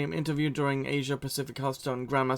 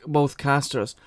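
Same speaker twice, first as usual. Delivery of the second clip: normal talking voice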